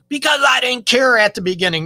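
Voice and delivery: high, loud snapped retort; arguing partner shouting back; mock shout back. Partner snaps back in argument.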